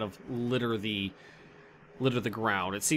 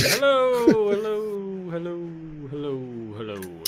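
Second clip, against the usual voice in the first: fading echo; 5 semitones higher, 4 dB louder, slower than usual. Acted. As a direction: let it die away like an echo down a shaft. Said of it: "you hear it echoing".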